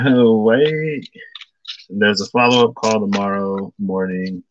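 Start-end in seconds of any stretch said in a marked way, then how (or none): 0.1-1.1 mock surprise. Acts out reaction to unexpected call.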